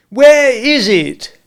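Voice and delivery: high, loud, slow demand; indignant demand. A narrator's reading. Walderwick pressing Smith for the umbrella, indignant. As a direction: press him hard for it.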